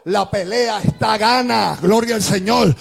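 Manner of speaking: driving, chanted sermon cadence; full-force preaching. Acted. Preacher exhorting believers through their struggles, full-force preaching. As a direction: proclaim each phrase with sustained force.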